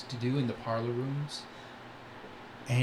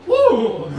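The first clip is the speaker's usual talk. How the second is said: loud, high comic whoa; startled yelp; 13 semitones higher, 12 dB louder than usual. Pretend. Game master voices a tuxedoed flamingo being knocked, loud startled yelp.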